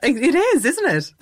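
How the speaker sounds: laughing agreement; teasing, laughing along. Real, not acted. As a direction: chuckle as you agree. Laughing together with guest.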